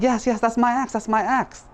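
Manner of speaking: very high, insistent cries; overeager claim. Acted. Dishonest woodcutter claims the silver axe, overeager. Liar insists after being questioned.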